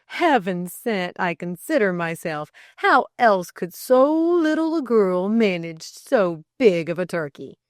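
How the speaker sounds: animated, loud mock astonishment; teasing. A narrator's reading. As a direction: tease with exaggerated amazement. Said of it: "teased Delcote".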